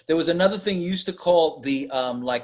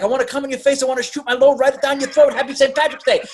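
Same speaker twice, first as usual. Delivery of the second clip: mock prank caller; 9 semitones higher, faster than usual. Prank caller voiced in retelling.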